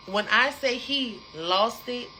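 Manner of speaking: normal talking voice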